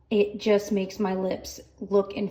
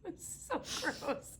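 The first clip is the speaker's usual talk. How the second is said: breathy, voiceless groan of revulsion; slow disgusted whisper; 7 semitones higher, 16 dB quieter, slower than usual. Real, not acted. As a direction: hush it out, grossed out. Disgusted recap of chewing noise.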